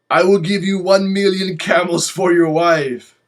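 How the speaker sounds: imitating an Egyptian man, accented and loud; hammed-up accented offer of camels; mock Egyptian accent. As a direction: put on a heavy Egyptian accent for his offer.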